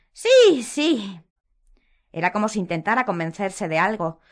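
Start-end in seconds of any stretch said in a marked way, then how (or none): 0.1-1.2 high, loud nervous yes; convincing herself, squeaky; Umbridge voice. A narrator's reading. Umbridge reassuring herself before the curse, high.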